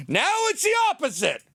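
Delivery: high shouted mock outrage. Real, not acted. Mocking the Al Gore climate flip, high shout. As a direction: throw it up high like a punchline.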